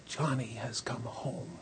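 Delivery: slow, breathy whisper of relief; hushed whisper. A narrator's reading. Narrator telling listeners the son is home, in a hushed whisper. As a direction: let it land softly, slowly.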